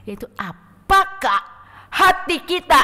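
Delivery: slow build to a loud, high cry on hati kita; preaching shout. Real, not acted. Preacher to congregation, a rising slow shout. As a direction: hammer the question home to the congregation.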